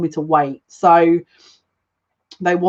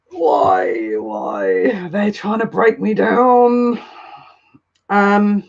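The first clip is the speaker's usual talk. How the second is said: breaking into tears; 4 semitones higher, much slower than usual. Real, not acted. Reader overcome by the lyrics mid-reading, crying. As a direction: sob it out, drawing the last words slowly.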